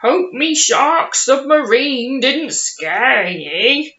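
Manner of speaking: put-on hearty pirate captain welcoming a child aboard. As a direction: boom it like a jolly pirate. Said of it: "I put my pirate voice on then".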